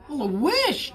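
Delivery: high, eager burst of delight; excited exclaim. A narrator's reading. Bullfrog thrilled at the wizard's offer, high excited exclaim. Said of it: "Jubal exclaimed".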